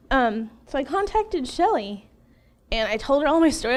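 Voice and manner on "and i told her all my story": usual speaking voice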